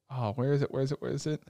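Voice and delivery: soft, trailing search mutter; muttering to oneself. Real, not acted. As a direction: mutter it under your breath while hunting for notes. Searching own notes while talking.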